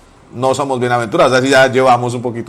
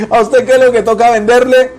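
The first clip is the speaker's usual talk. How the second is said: high, loud question through mock laughter; retold laughing taunt; 8 semitones higher, 5 dB louder than usual. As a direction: imitate the shopkeeper teasing, barely over his laugh. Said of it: "soltó la risa". (pregunta burlona, aguda y fuerte, entre risas)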